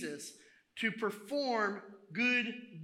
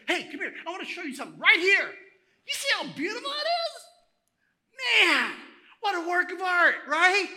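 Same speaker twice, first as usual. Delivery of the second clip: loud, high, gushing enthusiasm; excited showing off; 11 semitones higher, 13 dB louder than usual. Acted. Proud owner showing visitors a work of art, excited. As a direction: pull the guest over and marvel at it.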